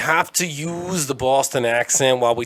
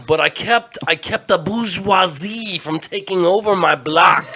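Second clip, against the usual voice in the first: put-on high, loud, slow old-timer voice; 7 semitones higher, 4 dB louder than usual. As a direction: play a loud old man reminiscing.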